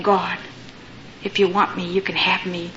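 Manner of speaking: low, soft retold prayer; humble prayer. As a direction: offer it quietly, with pauses between phrases. Orphan girl's prayer to God.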